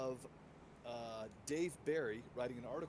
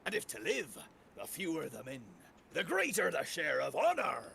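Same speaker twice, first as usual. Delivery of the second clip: lightly voiced pirate reciting Shakespeare; hint of pirate, declaiming Shakespeare; 3 semitones higher, 5 dB louder than usual. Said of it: "quote from Shakespeare, but talk like a pirate".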